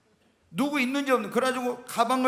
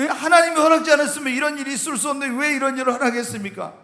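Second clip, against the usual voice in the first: louder, raised plea to God; pleading protest; 5 semitones higher, 6 dB louder, faster than usual. Retold or imitated. Pastor retelling protest to God, raised and pleading. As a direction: cry out the question. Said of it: "속으로 그런 거".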